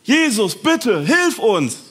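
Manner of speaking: loud, high, slow begging cry; desperate plea. Acted. Lepers beg Jesus from a distance, loud and desperate. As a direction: beg him for help from far off.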